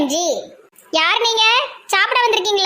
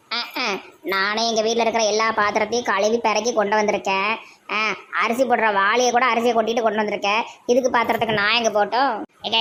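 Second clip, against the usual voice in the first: greedy guest; 6 semitones lower than usual. Acted. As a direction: lower put-on voice of a grabby guest. Greedy guest begs for food. (உணவுக்காக கெஞ்சும் தாழ்ந்த குரல் விருந்தாளி)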